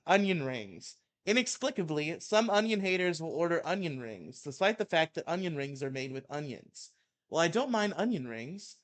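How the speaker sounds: heated mock-angry reading; ranting article read. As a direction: read it like a furious rant. Reads Maddox rant aloud.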